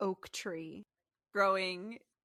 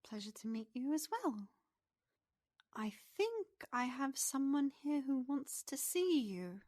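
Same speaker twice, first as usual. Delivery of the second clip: lilting pixie, rising higher; pixie character voice; 6 semitones higher than usual. Acted. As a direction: talk as a small pixie with news to share. Game master plays the pixie.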